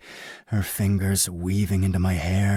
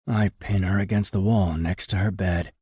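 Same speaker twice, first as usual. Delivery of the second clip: ordinary delivery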